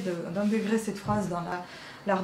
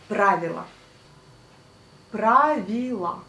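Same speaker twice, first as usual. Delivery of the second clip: правила with the A stressed, slow and clear; 4 dB louder than usual. Shown as a demonstration. Teacher to students, stressing the A. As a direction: lean on the A in правила, slowly. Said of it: "Pourquoi l'accent sur le A?".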